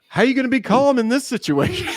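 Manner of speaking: high, loud incredulous outburst, imitated; hammed-up panic breaking into laughter; freaking-out catcher. Catcher answers pitcher who wants calm, imitated freak-out. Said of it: "Vinny was freaking out".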